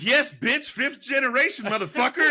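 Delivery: loud, high, defiant comeback; mock fierce retort. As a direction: snap it back loud and proud. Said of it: "I was like".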